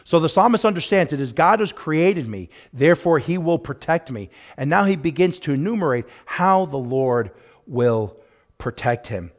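usual speaking voice

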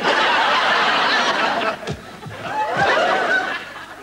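very high squeaky laugh; high puppet cackle. Acted.

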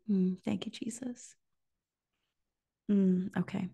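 hushed, holding back tears; emotional whisper. Real, not acted. Preacher moved by the vision, whispering. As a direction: whisper it, fighting the feeling. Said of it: "I don't wanna get emotional".

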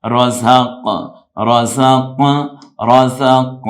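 রাযাক with a bouncing, echoed final q; loud রাযাক, releasing the last consonant with a bounce; qalqala. Shown as a demonstration. Teacher shows qaf takes qalqala, bouncing q. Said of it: "এই কফটা হলো কলকলা হবে".

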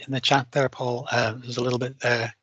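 ordinary talk

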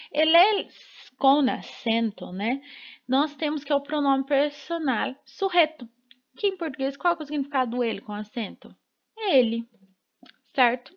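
usual speaking voice

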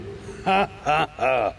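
short scoffing laugh; a dry, cheeky chuckle; laughing off the threat; wry laugh. Acted. Jack scoffs at the demon.

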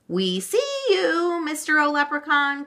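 put-on high, sing-out child's cry; triumphant high call. A narrator's reading. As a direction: call out high, drawing out "see you". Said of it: "cried Meg".

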